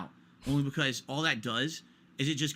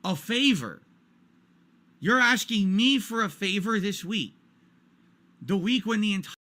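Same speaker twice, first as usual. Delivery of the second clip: louder, slower, outraged repeat; indignant disbelief; 6 semitones higher, 6 dB louder, slower than usual. Real, not acted. Streamer ranting to chat about a favor request, outraged and louder. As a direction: hammer the absurdity of the favor. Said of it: "Listen to this".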